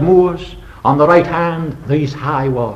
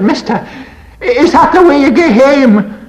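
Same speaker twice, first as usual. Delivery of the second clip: high, louder Scots laddie demanding; Scots boy shouting; 10 semitones higher, 4 dB louder than usual. Retold or imitated. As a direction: shout it in a Scots child's voice, higher. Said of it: "he shouted".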